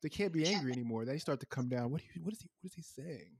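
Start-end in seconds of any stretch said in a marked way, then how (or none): 1.9-3.3 hushed, straining to catch the words; puzzled hush. Retold or imitated. Angry customer hushed by a whispering parent. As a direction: lean in and murmur, confused. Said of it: "drop your voice down to a whisper".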